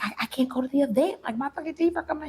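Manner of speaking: high-pitched mock panic; retold panicked protest. Retelling her protest to her partner about the event, mock panic. Retold argument over going out.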